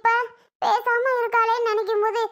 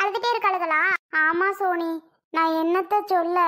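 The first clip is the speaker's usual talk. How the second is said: ordinary talk, nothing marked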